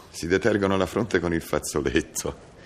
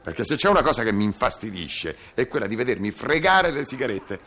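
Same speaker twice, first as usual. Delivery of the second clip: louder, higher, fast irritated bickering; 9 semitones higher, 3 dB louder than usual. Acted. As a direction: snap back at the wife. Bickering over cigarettes.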